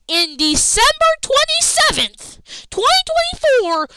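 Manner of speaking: can you believe this date; stunned fan questioning the booking; incredulous. Real, not acted. Incredulous complaint about booking.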